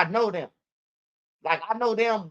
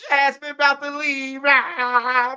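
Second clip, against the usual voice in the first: mock crying; 6 semitones higher, 4 dB louder, slower than usual. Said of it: "I'm about to start crying with Duck".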